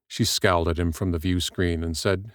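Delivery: ordinary delivery, nothing marked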